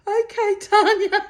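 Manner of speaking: high, loud imitation of chastened friends; exaggerated high-pitched giving in; mock obedient reply. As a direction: mimic two told-off friends answering in unison. Friends' meek reply, acted out.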